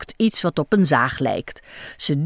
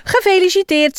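bright, higher happy congratulation; cheerful; 11 semitones higher, 3 dB louder, faster than usual. A narrator's reading. Iris congratulates Michiel, higher cheerful cry. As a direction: sing it out gladly. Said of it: "zegt ze blij".

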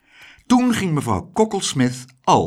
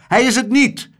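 hoge, luide tegenspraak; roepend; 11 semitones higher, 6 dB louder than usual. A narrator's reading. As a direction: roep het fel terug. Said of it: "riep meneer". (luid en hoog ontkennen)

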